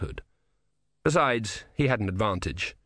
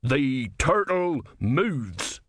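very slow, drawn-out, raised pitch; solemn password; 4 semitones higher, much slower than usual. Acted. Sergeant giving a secret sign to philosopher, slow portentous password. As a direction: stretch every word significantly.

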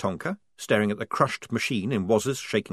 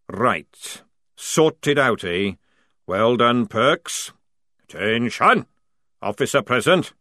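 bellowing sergeant; 2 semitones higher, 5 dB louder, slower than usual. A narrator's reading. Jackram ordering the squad, loud sergeant bark.

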